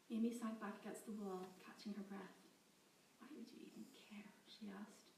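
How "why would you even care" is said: bitter whispered question. Acted. Captive Amy whispering bitterly to her guard. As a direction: breathe it out, exhausted.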